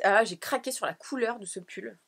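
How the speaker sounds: ordinary delivery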